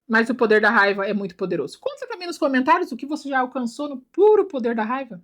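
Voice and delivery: ordinary talk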